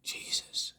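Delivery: breathless whispered Jesus; a last gasp of a prayer; whispered plea. Retold or imitated. Drowning in his dream, whispering a plea.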